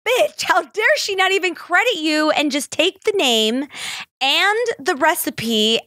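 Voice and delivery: high, indignant outburst on her friend's behalf; outraged rant. Retold or imitated. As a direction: pitch it up in mock outrage at the thief. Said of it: "I was so upset for her".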